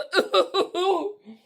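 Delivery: high laughter burst; laughing. Real, not acted. Laughing at the waiter story.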